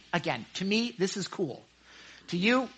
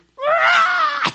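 loud, very high bird screech imitation; raven shriek; 9 dB louder than usual. Shown as a demonstration. Preacher shows the congregation how a raven sounds, raven shriek. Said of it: "you never hear a raven shriek".

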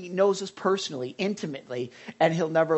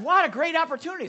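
excited; 11 semitones higher, 4 dB louder, faster than usual. Retold or imitated. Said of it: "and so they said".

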